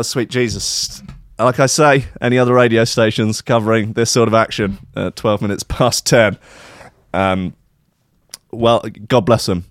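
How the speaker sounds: ordinary talk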